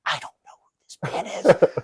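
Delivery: slow, hissed stage whisper; panicked whisper. Retold or imitated. Podcaster confiding in Mitch just before the interview, panicked whisper. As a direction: whisper it as a frantic secret confession.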